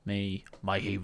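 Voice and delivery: put-on faltering, half-hearted Scottish accent; a hint of Scottish, abandoned quickly; attempted Scottish accent. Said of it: "trying to do a Scottish accent".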